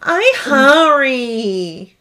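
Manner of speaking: put-on long-drawn, high, loud toddler whine; whining hungry child. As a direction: imitate a little kid whining for food. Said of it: "And that's how she says it".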